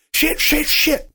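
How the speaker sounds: sharp high panicked curses; panicked cursing too loud. A narrator's reading. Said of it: "too loud".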